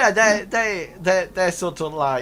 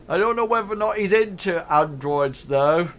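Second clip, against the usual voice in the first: raised, loud ruffian voice; 6 semitones higher, 5 dB louder than usual. Acted. Ruffian doubting Hank likes androids, raised voice. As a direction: pitch it up, chipping in. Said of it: "And it says".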